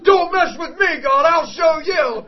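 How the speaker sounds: high, loud, laughing mock threat; hammed-up teen bravado, laughing; mocking defiance. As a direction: laugh through a cocky threat.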